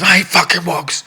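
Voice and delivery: high, loud mock shout of a hothead; retold shout. Fighter yelling insults in the change room, loud shout. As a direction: bellow it across the change room. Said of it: "And he's shouting in the change room".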